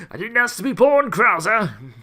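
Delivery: loud, high-pitched rebellious wail; mock teen outburst. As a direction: shout it like a hammed-up rebellious teen.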